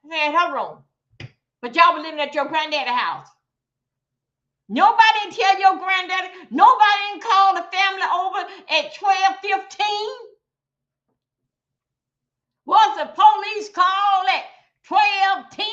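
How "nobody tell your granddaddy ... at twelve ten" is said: high, loud indignant questioning; outraged shout about the police call; angry shout. Real, not acted. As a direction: demand answers, fed up.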